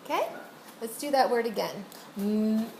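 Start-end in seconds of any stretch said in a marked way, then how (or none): 2.2-2.7 "M" held as a long level hum; sounded-out sound. Shown as a demonstration. Doing that word again, first sound held long. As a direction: hold the first sound, "M", as mmm.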